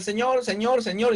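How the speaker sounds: Señor repetido, mucho más agudo, en tono de rezo vacío; repetición mecánica. Retold or imitated. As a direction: imitando a quien repite Señor sin pensar. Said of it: "Entonces cuando digo". (el creyente que dice Señor sin sentido, agudo y mecánico)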